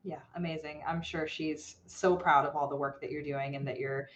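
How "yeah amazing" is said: normal talking voice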